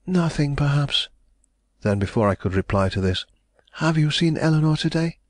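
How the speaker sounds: hopeful heiress pressing for news; young lady, anxious. A narrator's reading.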